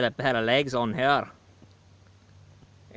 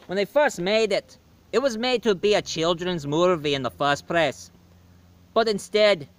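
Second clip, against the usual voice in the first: put-on chirpy stage-Irish leprechaun accent; hammed-up Irish leprechaun host; O'Lucky's Irish brogue. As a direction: play a cheeky Irish leprechaun with a thick brogue.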